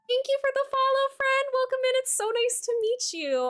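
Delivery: bubbly streamer thank-you voice; high chirpy thanks. Real, not acted. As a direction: gush the welcome up high. Thanking a new follower.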